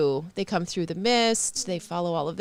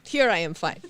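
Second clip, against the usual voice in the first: put-on Russian accent; 5 semitones higher than usual. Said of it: "The Russian accent is".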